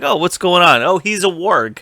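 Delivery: hammed-up surprised wildling; mock surprise. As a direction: play the wildlings spotting the warg, wide-eyed. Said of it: "It's like".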